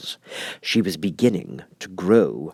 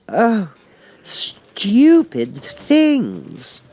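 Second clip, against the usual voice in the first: high, loud, drawn-out indignant cry; loud indignant Alice; 11 semitones higher, 5 dB louder, much slower than usual. Acted. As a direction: burst out indignant, stretching the words. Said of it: "in a loud, indignant voice".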